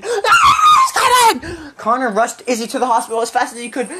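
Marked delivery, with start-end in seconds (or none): 0.0-1.7 high, loud scream in character. A narrator's reading. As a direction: scream it out high, mid-reading. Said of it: "Izzy screamed".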